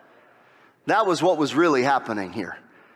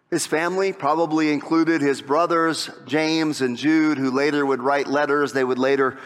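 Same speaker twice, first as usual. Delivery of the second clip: ordinary talk, nothing marked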